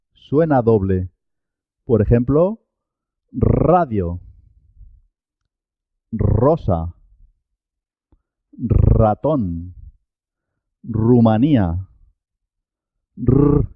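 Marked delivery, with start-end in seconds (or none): 3.3-11.9 long trilled R starting each word; double initial R. Shown as a demonstration. Teacher gives examples of initial R sounding double, trilled. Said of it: "pero suena doble". 13.2-13.7 isolated long rolled rrr; double R sound. Shown as a demonstration. Teacher isolates the sound for learners, a double rolled R.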